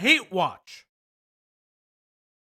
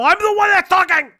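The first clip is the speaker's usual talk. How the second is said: loud frustrated yell, much higher; shout; 10 semitones higher, 11 dB louder than usual. Real, not acted. Streamer shouting at co-host who hogs the stream, full yell. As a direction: yell it out in exasperation. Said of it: "No screaming".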